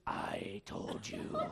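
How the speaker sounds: very low, slow, unvoiced murmur; a hint of a grumbling wife. Pregnant wife reminding husband she warned him, low mock mutter. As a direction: mutter it slowly like a reproachful wife.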